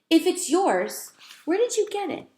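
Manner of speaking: put-on a boy doubting a mouse; lightly voiced boy, puzzled; curious boy. A narrator's reading. Boy Garth questions the mouse.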